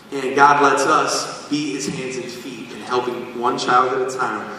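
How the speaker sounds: normal talking voice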